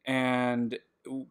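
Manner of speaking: normal talking voice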